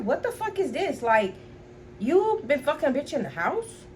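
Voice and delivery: high-pitched, indignant confrontation; retold anger. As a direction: re-enact confronting the boyfriend in a high voice. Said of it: "that's how mad I was".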